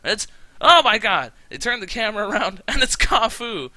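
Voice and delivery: loud, high-pitched shock; shocked reveal. Acted. Storyteller revealing the kidnapper on camera, loud hammed-up shock. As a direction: exclaim it like a huge plot twist.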